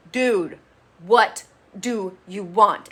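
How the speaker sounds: slow, loud, drawn-out exasperation; exasperated demand. Retold or imitated. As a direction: stretch it out like you've had enough. Johnny confronting Leo, voiced.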